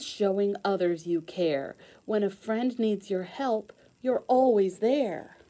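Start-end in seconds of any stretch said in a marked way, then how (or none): none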